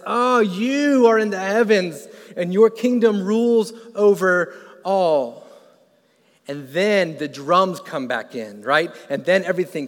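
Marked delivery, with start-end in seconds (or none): 0.1-5.5 high, loud and slow, drawn out in wonder; awestruck proclamation. Retold or imitated. David as it all clicks, slow loud awestruck proclamation. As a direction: let it all click, then declare. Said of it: "it all clicks and he says".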